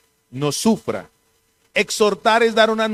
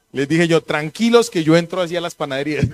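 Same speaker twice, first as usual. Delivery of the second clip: laughing; 4 dB louder than usual. Real, not acted. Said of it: "laughing while telling".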